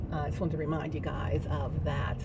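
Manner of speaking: ordinary talk, nothing marked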